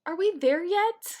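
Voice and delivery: put-on loud, high whiny child; whiny kid. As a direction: whine it from the back seat. Kid in the car.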